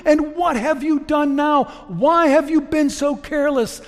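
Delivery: high, loud anguished cry; Miriam crying out. Acted. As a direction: wail at the servant in despair, far above your own voice. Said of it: "she cries out".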